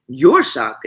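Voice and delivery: loud indignant outburst; exclaimed. A narrator's reading. Mother fires back at her daughter, loud and indignant. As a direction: throw the words back at her. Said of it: "exclaimed her mother".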